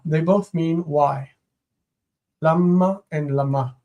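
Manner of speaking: ordinary delivery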